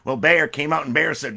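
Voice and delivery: usual speaking voice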